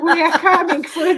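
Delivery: real laughter while joking back; laughing tease. Real, not acted. Teasing reply while laughing.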